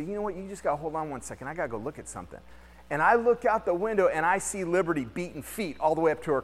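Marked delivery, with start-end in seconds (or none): 0.0-2.4 softer, quicker excuse to a customer; telling the customer to hold on, hushed. Retold or imitated. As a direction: slip away politely, keeping it down. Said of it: "I say".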